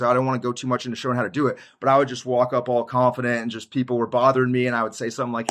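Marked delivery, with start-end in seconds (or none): none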